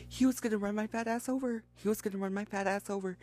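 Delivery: fast tumbling retelling; rushed. Real, not acted. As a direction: blurt it out quickly. Rushed punchline after build.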